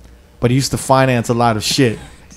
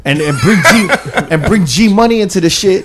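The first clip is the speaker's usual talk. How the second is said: higher, breaking up with laughter mid-sentence; laughing indignation; 7 semitones higher, 4 dB louder than usual. Real, not acted. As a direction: protest while cracking up. Storyteller cracks up at own story.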